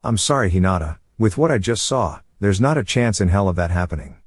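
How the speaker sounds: ordinary delivery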